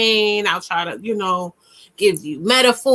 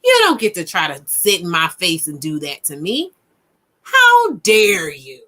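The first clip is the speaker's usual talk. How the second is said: righteous voice; 4 semitones lower than usual. Shown as a demonstration. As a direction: stand your ground from the diaphragm. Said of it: "You hear that? ... That's your righteous voice".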